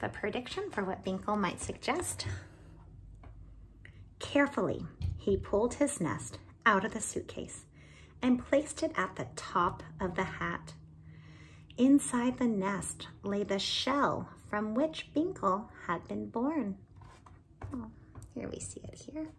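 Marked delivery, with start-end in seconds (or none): 4.2-17.9 put-on lively read-aloud for children; animated storyteller voicing each character; story narration. A narrator's reading. Reading picture book aloud. 18.3-19.3 unvoiced hushed aside; whisper. Real, not acted. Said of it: "aside whispered over the picture".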